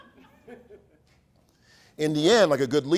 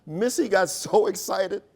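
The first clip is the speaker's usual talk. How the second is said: usual speaking voice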